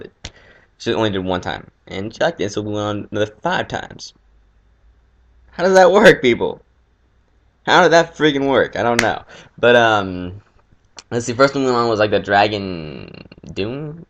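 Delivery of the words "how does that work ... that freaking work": exasperated. Real, not acted. Indignant rhetorical question to viewers.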